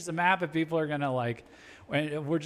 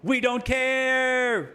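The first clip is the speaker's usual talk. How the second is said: mock American, higher, slow and drawn out; brash American; 9 semitones higher, 4 dB louder, much slower than usual. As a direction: play the smug superpower shrugging it off.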